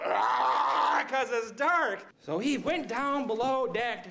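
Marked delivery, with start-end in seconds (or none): 0.1-1.1 short hammed-up yelp; a lions' mock panic cry; mock lion panic yell. Lions flipping out in the dark, a yelp. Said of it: "lions start flipping out".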